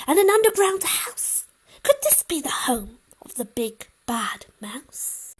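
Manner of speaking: put-on a brave child on the hunt; eager high child voice; excited child. Child finds mouse tracks.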